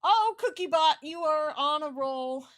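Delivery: loud, high, slowed cheer; gleeful loud praise; excited praise. Real, not acted. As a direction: cheer the game on, big and drawn out. Praising the game.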